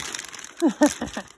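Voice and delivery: quick amused chuckle; short burst of laughter. Real, not acted. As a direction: laugh briefly at your own imitation. Laughs at own imitation.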